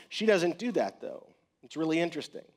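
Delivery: usual speaking voice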